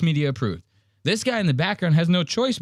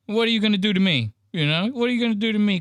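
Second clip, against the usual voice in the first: mock taunting; 7 semitones higher, faster than usual. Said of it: "He goes".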